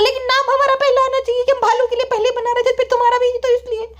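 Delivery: high, insistent daughter voice; whiny daughter. Acted. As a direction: play the daughter pleading in a high pitch. Daughter figure again. (ज़िद्दी बेटी की ऊँची आवाज़)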